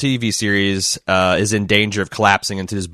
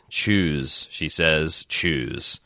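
choked cold voice; 4 semitones lower, 5 dB quieter, much slower than usual. Acted. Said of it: "choked and broken, as cold as a stream of ice".

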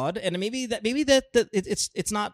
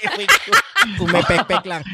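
laughing; 4 semitones higher, 5 dB louder than usual. Real, not acted. Laughing while joking.

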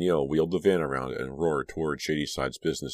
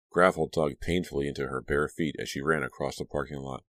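ordinary delivery

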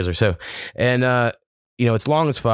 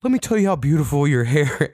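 mock gushing waitress; 6 semitones higher than usual. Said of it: "has to come up and be like".

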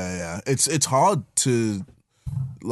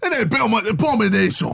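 mock Nigerian accent; 7 semitones higher, faster than usual. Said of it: "This is an abomination".

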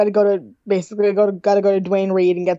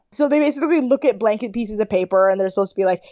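ordinary delivery

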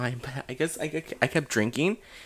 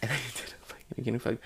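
whispering; 3 semitones lower, 11 dB quieter than usual. Real, not acted. Whispered confession.

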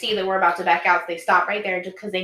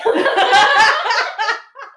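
shrieking laugh; high cackling laugh; 14 semitones higher, 8 dB louder than usual. Real, not acted. Laugh after joke.

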